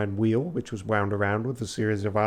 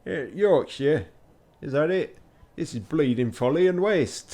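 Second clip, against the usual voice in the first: badly faked Yorkshire, pitched higher; attempted Yorkshire accent; 4 semitones higher than usual. Shown as a demonstration. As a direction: have a shaky go at Yorkshire. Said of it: "I can't do Yorkshire".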